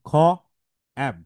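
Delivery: খ and অ্যাব said separately with long pauses; sounding out. Shown as a demonstration. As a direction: break it into an aspirated খ, then অ্যাব. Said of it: "খ্যাব এভাবে হয়".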